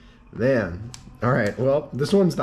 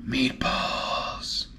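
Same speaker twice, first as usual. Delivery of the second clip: airy, gasped-out word of delight; breathless gasp; 12 semitones higher than usual. Real, not acted. Vlogger spotting meatballs, gasped breathless delight. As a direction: breathe it out in amazement.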